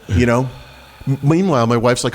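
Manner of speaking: normal talking voice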